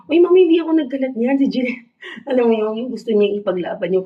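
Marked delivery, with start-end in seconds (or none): 0.1-1.4 high, fast, loud protest voice of the cat; playful high cat voice pleading innocence; cat talking back. Retold or imitated. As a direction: squeak out the cat's denial to mom. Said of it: "sabi niya, yata siguro".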